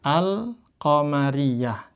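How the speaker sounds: al-qamariyah recited slowly with a clear lam; Arabic recitation. Shown as a demonstration. Teacher demonstrating al-qamariyah, slow recitation. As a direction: drag out al-qamariyah, sounding the l. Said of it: "Bacanya sama seperti ini".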